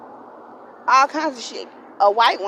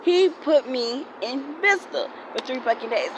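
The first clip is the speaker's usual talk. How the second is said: ordinary talk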